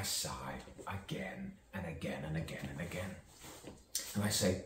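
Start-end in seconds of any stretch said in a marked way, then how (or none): 0.0-3.1 whisper. Acted. Trails into whispered repetition.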